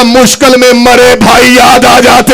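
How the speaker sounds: slow, loud grieving shout; drawn-out mourning shout; anguished cry. Retold or imitated. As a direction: cry out for the lost brother. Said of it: "Hussain चीख मार के कहता".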